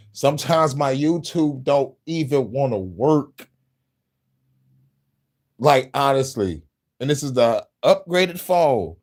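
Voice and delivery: worked-up, incredulous complaint; exasperated rant. Real, not acted. Exasperated rant about YouTube failing.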